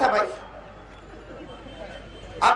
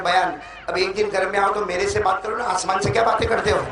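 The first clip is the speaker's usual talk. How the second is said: ordinary talk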